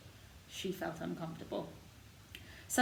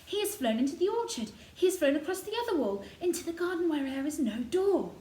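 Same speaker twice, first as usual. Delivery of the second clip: excited; 9 semitones higher, 6 dB louder than usual. A narrator's reading. Mary following robin excitedly.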